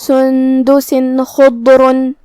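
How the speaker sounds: سندس خضرن very slow, the tanween said clearly without ghunna; سندس خضرن with a clear, un-nasalised نون before خ; clear izhar. Shown as a demonstration. Teacher demonstrates izhar without ghunna, slow and clear. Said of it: "تنوین ظاہر کریں گے اور بغیر غنہ سے".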